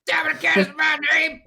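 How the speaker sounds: high shouted lyric imitation; mock song vocal. Bandmates randomly quoting lyrics at each other, high comic shout. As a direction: shout it slowly, high and silly. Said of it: "randomly look at each other like".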